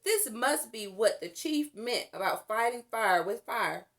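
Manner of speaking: usual speaking voice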